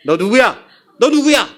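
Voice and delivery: rising mock-stern demand; pressing interrogation. Suspicious mom grilling the supposed son, pressing him sharply. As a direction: fire the question, harder the second time. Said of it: "막 다그쳤어요".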